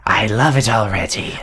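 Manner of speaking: voiceless serpent approval; whispery creature voice; whispering snake guard. Acted. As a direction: whisper it as a pleased monster. Second snake guard likes nickname.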